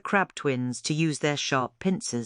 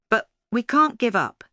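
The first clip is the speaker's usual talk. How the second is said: determined; 8 semitones higher, 5 dB louder than usual. A narrator's reading. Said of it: "determination lighting up his eyes".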